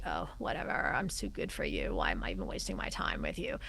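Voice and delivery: low, unvoiced, disdainful murmur; bored dismissive. Shown as a demonstration. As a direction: sound like you can't be bothered. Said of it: "The one being the".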